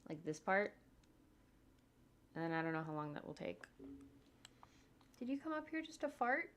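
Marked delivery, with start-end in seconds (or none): 5.2-6.5 high-pitched question to a pet; pet-owner voice, pitched up; talking to dog. Real, not acted.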